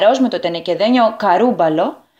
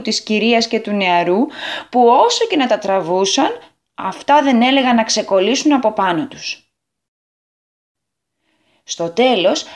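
usual speaking voice

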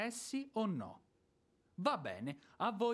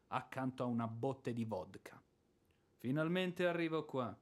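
normal talking voice

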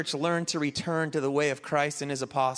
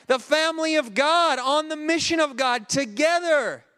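higher, louder rising preaching; preaching at full force; 5 semitones higher, 4 dB louder than usual. Acted. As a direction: build it up to a strong close. Sermon builds to climax.

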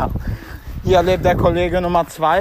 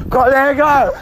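calling out; 9 semitones higher, 5 dB louder than usual. Real, not acted. Calling out to friend.

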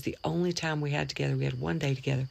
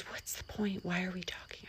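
whisper; 4 semitones higher, 11 dB quieter than usual. Retold or imitated. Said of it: "She goes".

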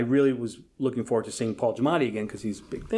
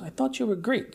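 mild mock surprise; 9 semitones higher, 3 dB quieter than usual. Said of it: "and he goes".

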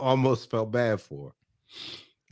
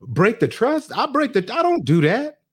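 high, louder, offended comeback; indignant retort; 10 semitones higher, 5 dB louder than usual. Retold or imitated. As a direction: play it as an outraged wife throwing the accusation back. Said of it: "and Danielle's like".

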